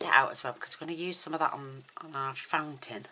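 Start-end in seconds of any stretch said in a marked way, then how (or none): none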